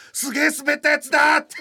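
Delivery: high and loud, laughing through it; excited shout, laughing. Retold or imitated. To his listener about the pool slide, thrilled laughing shout. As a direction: yell it like a thrilled kid remembering.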